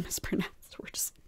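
sheepish whisper with a laugh. Real, not acted. To viewers, apologising for mangling the name, whispering. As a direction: drop to a whisper, half laughing at yourself. Embarrassed over mispronouncing Spurza.

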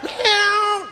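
high meowing like a cat. Retold or imitated. Comic imitating a cat meowing at night. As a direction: yowl a meow up high. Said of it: "that's a cat".

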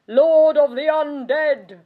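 loud, booming mock proclamation; grandiose mockery. Countess quoting her husband's self-given title, mock-grandiose. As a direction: declare the title with mocking pomp.

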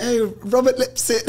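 high, laughing mock English accent; terrible English accent. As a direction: badly imitate an English caller, cracking up. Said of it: "My English accent's terrible".

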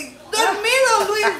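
high, loud laughing disbelief. Real, not acted. Wife laughing that her husband slept through it.

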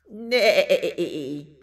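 loud, wavering whinny; horse neigh. Pretend. Storyteller springing the horse on children, loud neigh. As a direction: imitate a horse's quavering neigh. Horse noise in picture book.

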